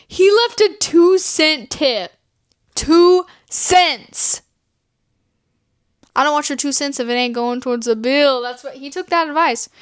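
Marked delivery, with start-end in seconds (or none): 0.1-4.4 slow, higher, drawn-out outrage; appalled disbelief. Real, not acted. Friend revealing the date's stingy tip, appalled. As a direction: spell out the insult slowly, higher.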